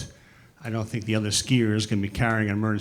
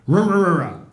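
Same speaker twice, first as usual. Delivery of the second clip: imitated big dog bark; 6 semitones higher, 5 dB louder than usual. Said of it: "his dog would come flying".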